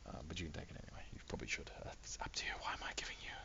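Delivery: very soft, whispery self-questioning; muttered aside. Real, not acted. Game master second-guessing his own free loot, muttered aside. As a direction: mutter doubts under your breath.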